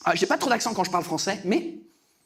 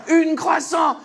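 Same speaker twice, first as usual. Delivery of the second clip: loud, higher jeering repeat; mocking echo; 6 semitones higher, 5 dB louder, much slower than usual. Baker repeating his mistake, loud jeering mimicry. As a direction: parrot the mistake loudly in mockery.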